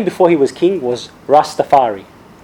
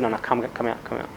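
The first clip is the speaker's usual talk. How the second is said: soft, quiet coaxing; calming coax; 5 semitones lower, 12 dB quieter than usual. Retold or imitated. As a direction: gently talk him out of the plane. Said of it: "somebody calmed him down and said".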